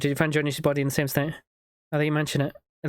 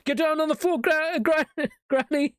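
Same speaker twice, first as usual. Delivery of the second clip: much higher mock shout, breaking into laughter; raiding agent; 12 semitones higher than usual. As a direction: yell at granny, laughing. Mock FBI raid on granny.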